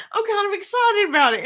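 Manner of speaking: high giggly nervous laugh. Real, not acted. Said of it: "It makes me nervous giggle".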